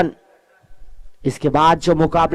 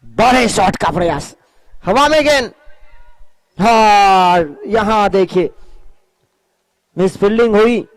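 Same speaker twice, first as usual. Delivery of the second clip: rising live play-by-play, drawn out; excited cricket commentary; 3 semitones higher, slower than usual. Acted. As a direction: call the run-out chance as it happens. Live play-by-play of run-out chance.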